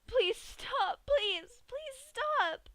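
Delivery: very high, loud desperate pleas; crying and begging. Acted. Dash begs Pinkie to stop the hammer, high loud crying. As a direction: beg through tears. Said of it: "Dash burst out crying and begging".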